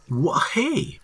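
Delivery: very high, startled protest; alarmed. A narrator's reading. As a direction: stammer into a startled hey. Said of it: "he says in alarm".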